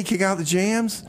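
ordinary talk

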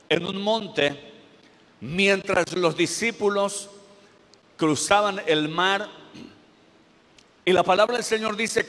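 projected pulpit retelling of scripture; preaching. Real, not acted. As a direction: preach it to the congregation with emphatic pauses. Preaches on the Bible passage.

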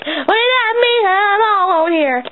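high-pitched left-out complaint; indignant interruption. Acted. Friend left alone butts in, indignant. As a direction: cut in, gasping and put out.

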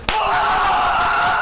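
high scream with a laugh; shrieking jackpot winner. Real, not acted. Big slot win celebration.

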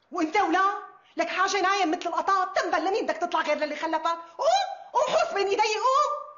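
loud, high bullying tirade; yelling him up onto his feet; angry scolding. Acted. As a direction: berate a lazy underling at full volume. Boss scolds a sleeping lazy boy.

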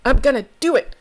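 loud, fast, grudging resolve; reluctant. Retold or imitated. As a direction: force yourself to agree. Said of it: "You're like".

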